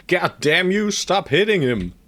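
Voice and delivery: annoyed shout; frustrated yell. Real, not acted. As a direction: yell at the screen in real frustration. Units attacking his hero.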